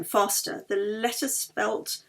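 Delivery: ordinary delivery, nothing marked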